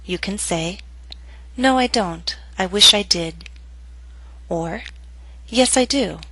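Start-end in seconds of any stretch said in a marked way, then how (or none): 1.6-3.5 "No, I don't. I wish I did." each sentence falling; falling intonation. Shown as a demonstration. Teacher models answer choice, falling intonation. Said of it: "Both will be read with falling intonation". 5.5-6.2 "Yes, I do." high, then falling on "do"; falling intonation. Shown as a demonstration. Teacher models second answer choice, falling intonation. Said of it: "Both will be read with falling intonation".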